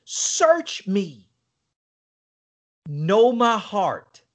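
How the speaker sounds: slow, earnest prayer; prayerful plea. Retold or imitated. Quoting David's psalm to his friend, slow prayer. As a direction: pray it slowly. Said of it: "like what David wrote in".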